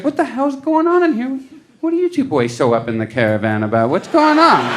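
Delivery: high-pitched wife demanding answers; exasperated wife. Retold or imitated. Wife walking in on two men's argument, exasperated. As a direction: burst out high like a startled wife. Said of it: "his wife was coming in".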